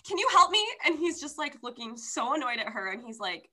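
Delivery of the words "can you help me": high, loud pleading question; urgent plea. Retold or imitated. Girl bursts into the inn asking the man for help, urgent high plea. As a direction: beg a stranger for help, up high. Heroine begs stranger at inn.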